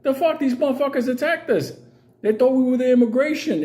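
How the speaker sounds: high-pitched protest, pleading his innocence; squeaky are-you-kidding-me defence; hammed-up outraged explanation; mock indignant. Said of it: "I was like".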